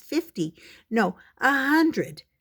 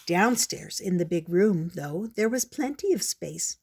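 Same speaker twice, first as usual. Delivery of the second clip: usual speaking voice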